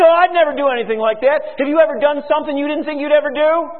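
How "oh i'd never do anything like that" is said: high, loud, fast huffy denial; mock indignant protest. Preacher voicing a congregant who denies any sin, indignant. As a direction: play the offended churchgoer to the hilt. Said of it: "You say".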